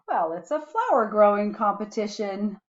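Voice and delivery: ordinary delivery, nothing marked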